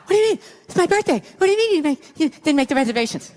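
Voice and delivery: hammed-up indignant panic; freaking out. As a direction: imitate a wife flipping out, pitch shooting up. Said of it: "She starts freaking out".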